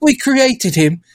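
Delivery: high, pleading British orphan voice, slowed on the middle line; hammed-up pleading British urchin; British beggar. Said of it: "I don't know why they're British".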